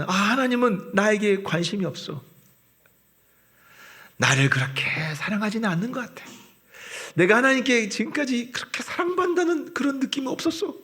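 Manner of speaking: mock complaint of a disappointed churchgoer; doubting believer. Doubting believer's inner voice quoted.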